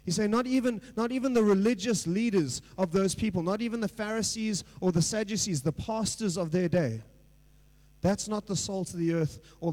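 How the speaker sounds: loud, driving preacher delivery; fervent preaching. Acted. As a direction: preach it out with rising force to the congregation. Preaching at full force.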